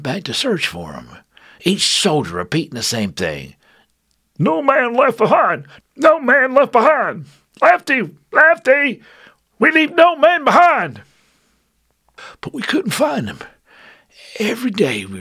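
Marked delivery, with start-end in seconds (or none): 4.4-11.0 calling out. Acted. Soldiers calling out searching.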